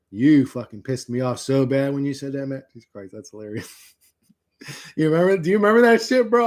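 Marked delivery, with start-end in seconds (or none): none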